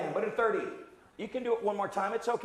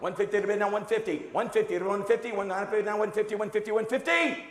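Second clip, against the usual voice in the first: auctioneer; faster than usual. Acted. Auctioneer calling bids.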